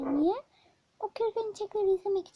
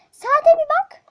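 furious mother; 11 semitones higher, 15 dB louder, slower than usual. Acted. Mother scolds son.

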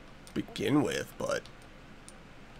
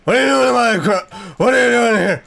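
higher, louder startled demand; shouting at a creature in the crops; alarmed yell; 8 semitones higher, 4 dB louder than usual. Real, not acted. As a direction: confront the intruder sharply. Confronts creature in field.

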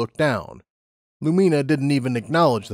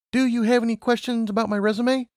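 high-pitched job applicant voice; Lumina; 11 semitones higher than usual. A narrator's reading. Lumina asking her interviewer about her resume, high-pitched voice. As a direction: lift it well up, a polite question.

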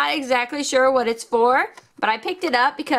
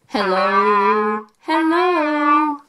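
through a megaphone; 3 semitones higher, much slower than usual. Shown as a demonstration. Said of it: "let's start with the megaphone".

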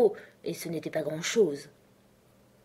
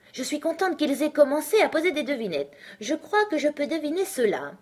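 high, lively character voice; eager Alice; 9 semitones higher than usual. Acted. Alice welcomes the riddle game, eager and high-voiced. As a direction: speak up high, pleased to be playing.

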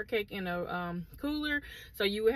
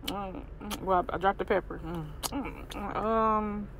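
talking between chews; muffled closed-lip speech; mouth full, chewing; 5 semitones lower than usual. Real, not acted. Seen 1: Bites food at -1, lips closed, cheeks working through clip.

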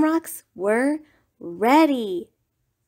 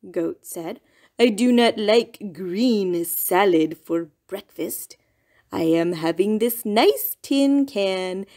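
Goat's voice. Acted. Goat calmly telling Rabbit the wind brought them. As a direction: play the easygoing goat explaining.

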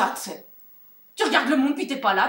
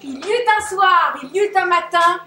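ton biblique; 6 semitones higher, 8 dB louder than usual. Acted. Formule biblique déclamée.

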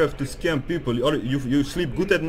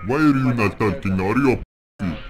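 lower, processed voice; voice changer; 4 semitones lower than usual. Real, not acted. Hacker goading a silent scammer, low voice changer. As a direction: goad him through a voice changer. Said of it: "get off that voice changer".

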